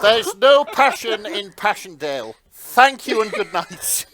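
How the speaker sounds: hammed-up stand-up farewell; mock grand sign-off. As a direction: deliver it like a comedian's big finish, booming.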